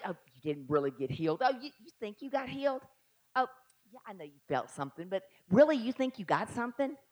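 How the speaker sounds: quiet, scoffing doubter voice; a hushed, mocking skeptic. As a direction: sneer it like a doubting friend.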